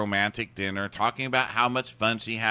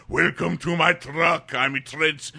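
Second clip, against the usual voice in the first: mock French accent; 4 semitones higher, slower than usual. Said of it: "what are you, French now".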